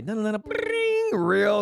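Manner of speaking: high, slow pleading no no no; mock desperate plea. Host mocking himself begging in the bathroom, high whiny plea. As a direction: whine it up high like begging for a reel. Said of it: "I'm like".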